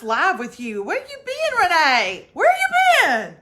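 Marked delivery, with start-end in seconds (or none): 0.8-2.3 calling out. Real, not acted. Greeting a viewer by calling out. 2.3-3.4 drawn-out high call. Real, not acted. Greeting a long-lost viewer with a drawn-out high call. Repeat called out, stretched.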